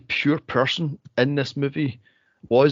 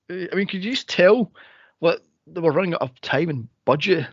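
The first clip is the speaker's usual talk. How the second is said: ordinary delivery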